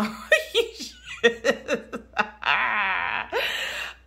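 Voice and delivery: high, loud laughing fit; can't stop laughing; helpless laughter. Real, not acted. Cracking up at her dog by the pool, laughing fit. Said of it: "I'm just cracking up".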